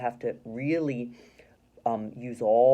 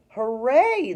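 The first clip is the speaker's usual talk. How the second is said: shouted hooray; 8 semitones higher, 8 dB louder than usual. Shown as a demonstration. Rereads Lucy's shout.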